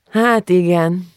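slow, mildly resigned sigh; sighing agreement. A narrator's reading. Girl agrees with the librarian about fashion, sighing slowly. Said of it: "Gitta felsóhajtott".

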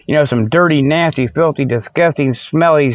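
ordinary delivery

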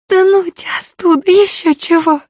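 high, spiteful snap through teeth; hissing with hatred. Acted. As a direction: spit it out in pain and scorn. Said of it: "Почти с ненавистью процедила девка".

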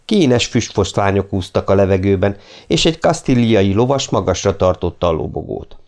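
ordinary delivery, nothing marked

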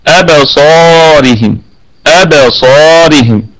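slow recitation of أبصارهم with a heavy, full-mouthed ص; drawn-out أبصارهم, ص heavy and the ر thin; tajweed demo. Shown as a demonstration. Teacher reciting أبصارهم slowly for students, heavy ص. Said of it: "فهي في أعلى درجات التفخيم".

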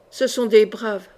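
usual speaking voice

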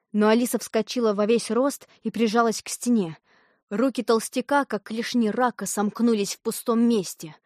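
plain reading voice

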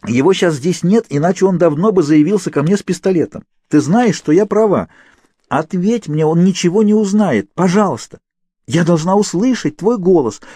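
high, loud begging; loud hoarse pleading. A narrator's reading. Kate loudly begging Naomi for two minutes of talk. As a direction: beg her to answer, growing hoarse. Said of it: "В её громком голосе начали прослушиваться хрипы".

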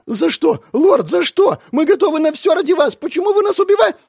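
high, panicked and fast; frightened pleading. Acted. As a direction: beg in terror. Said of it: "Они испуганно вскинули головы".

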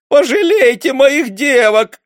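high loud slow begging wail; sobbing plea. A narrator's reading. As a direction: beg through tears for the girls. Sobbing mother begging for daughters. (рыдая, умоляет)

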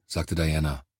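usual speaking voice

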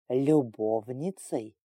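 low, slow, drawn-out question; menacing query. A narrator's reading. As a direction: stretch the word out in suspicion. Lord questions the overheard word. (низко, медленно, с угрозой в вопросе)

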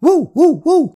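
loud, high playful hoots; dog barking. Pretend. Grandson hiding in the hallway, playfully barking. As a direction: bark like a little pretend dog.

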